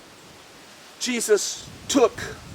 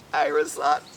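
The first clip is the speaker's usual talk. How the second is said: ordinary delivery, nothing marked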